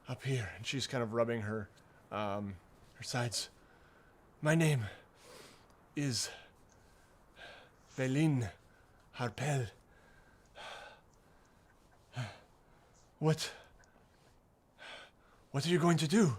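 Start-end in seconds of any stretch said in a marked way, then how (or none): 4.4-16.4 lightly voiced old woman, halting with long pauses; older woman stranger. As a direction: answer haltingly, lightly in character as an older traveler.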